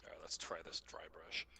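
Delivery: soft unvoiced murmur to himself; whisper. Real, not acted. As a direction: whisper it while concentrating. Painting, talking to himself.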